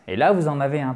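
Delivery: normal talking voice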